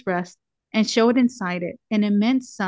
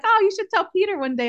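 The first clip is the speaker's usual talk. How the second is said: much higher, quick, lightly voiced eager nun; imitating Sister Magdalene; 10 semitones higher, faster than usual. Sister Magdalene nudging her to tell Peter, high quick mock voice. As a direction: chirp it up high and fast like an excited friend. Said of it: "she's like".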